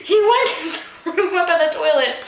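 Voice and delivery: high, giggling narration; can't keep a straight face; laughing while reading. Real, not acted. Narrator cracking up at her own story, laughing. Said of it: "laughing through reading".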